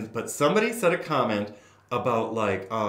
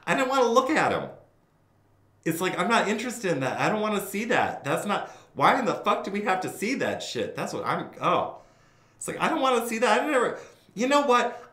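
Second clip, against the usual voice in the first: disgusted rant; 10 semitones higher, 5 dB louder than usual. Real, not acted. Disgusted outburst.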